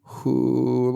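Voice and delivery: usual speaking voice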